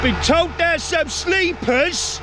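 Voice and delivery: playful high, slow mock voice. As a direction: stretch it out high and silly, teasing. Repeats phrase in a put-on voice.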